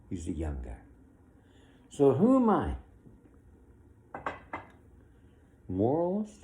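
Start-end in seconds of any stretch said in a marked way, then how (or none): 1.9-6.4 high, loud rhetorical challenge; indignant. Real, not acted. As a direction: throw the question out defiantly.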